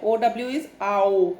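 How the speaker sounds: normal talking voice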